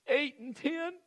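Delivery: high, squeaky sob-talk; hammed-up whimpered answer; mock crying. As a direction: imitate a tearful mother's small high voice. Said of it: "she was sitting there crying".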